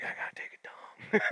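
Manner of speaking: hushed, voiceless aside; mock whisper. Retelling his jail-cell urge at six a.m., mock whisper. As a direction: whisper it like an urgent confession.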